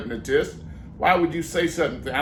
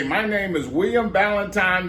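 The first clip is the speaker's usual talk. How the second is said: normal talking voice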